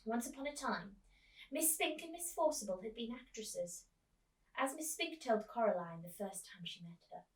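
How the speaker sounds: ordinary delivery, nothing marked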